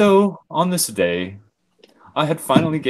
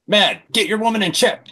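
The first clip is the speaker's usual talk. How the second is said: annoyed; 12 semitones higher, 6 dB louder than usual. Acted. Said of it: "Jay got more annoyed".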